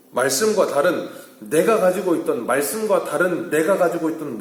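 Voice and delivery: high, loud pulpit delivery; forceful preaching. Acted. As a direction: drive the point home to the congregation. Preacher exhorts congregation. (목소리를 높인 힘찬 설교조)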